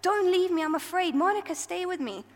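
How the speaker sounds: shouted plea to the angel; retold shout. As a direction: call out to Monica, afraid. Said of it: "she shouts out".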